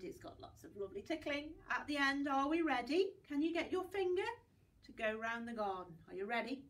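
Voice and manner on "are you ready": usual speaking voice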